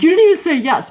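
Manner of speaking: high mock child protest; lightly voiced kids calling out; children pointing out she forgot; kids chiding. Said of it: "the children were like".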